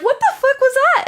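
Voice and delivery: high, loud startled shriek; shocked yelp. Retold or imitated. Retelling friend pinched by crab, high startled shriek. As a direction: squeal it like something grabbed you. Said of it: "And she was like".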